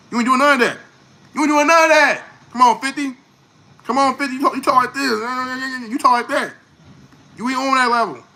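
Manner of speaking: high-pitched taunting, voicing the rapper's jab; taunting challenge. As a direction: talk down to a rival, pitched well up. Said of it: "that's what he's saying to you".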